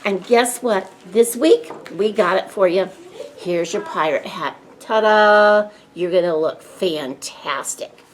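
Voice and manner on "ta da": loud showy ta-da; ta-da reveal. Real, not acted. Librarian unveiling a paper pirate hat to kids, excited. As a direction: present it like a magic trick.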